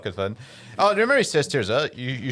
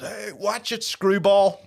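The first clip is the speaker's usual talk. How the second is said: mock gruff film voice; 8 semitones higher, 4 dB louder, slower than usual. Quoting old film line.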